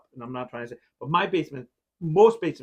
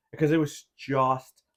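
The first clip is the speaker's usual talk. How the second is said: ordinary talk, nothing marked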